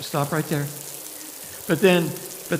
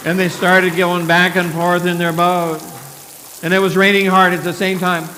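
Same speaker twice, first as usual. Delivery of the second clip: loud, rising storytelling; dramatic storm telling; 4 semitones higher, 6 dB louder than usual. A narrator's reading. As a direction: build the storm's drama loudly.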